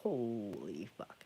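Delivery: awed dread. Retold or imitated. Seeing werewolf in dream.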